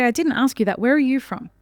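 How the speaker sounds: ordinary talk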